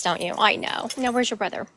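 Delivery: usual speaking voice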